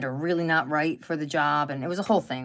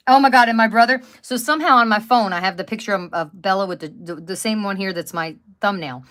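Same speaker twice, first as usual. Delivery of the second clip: ordinary talk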